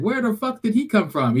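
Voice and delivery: high-pitched imitation of baffled parents; mock bewilderment. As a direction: act out the stands' surprise. Parents react to kid on field.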